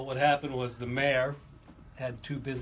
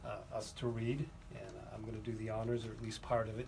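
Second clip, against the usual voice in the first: ordinary talk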